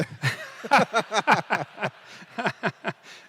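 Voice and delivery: high, bursting laughter; long laugh. Real, not acted. Host amused by the underwater lobster answer, long laugh. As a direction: crack up for a few seconds.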